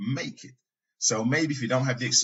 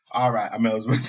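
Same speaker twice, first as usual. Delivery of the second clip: resigned sigh, laughing; 5 dB louder than usual. Retold or imitated. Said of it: "she'll be like".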